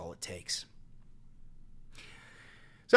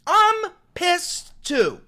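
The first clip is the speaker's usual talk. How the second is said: slow, drawn-out shout; 9 semitones higher, 7 dB louder, much slower than usual. Real, not acted. Repeating the anger slowly.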